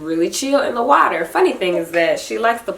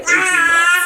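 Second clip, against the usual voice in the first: higher, cracking with tears; teary laugh; 10 semitones higher, 4 dB louder than usual. Real, not acted. Mother to viewers, laughing through tears about nursing. Tearful about breastfeeding ending.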